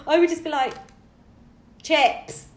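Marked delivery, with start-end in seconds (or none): 1.8-2.5 over-enunciated single word; deliberate Brit-abroad enunciation; slower and louder. Acted. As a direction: order it as if they barely understand English. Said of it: "speak slower and louder till someone understands".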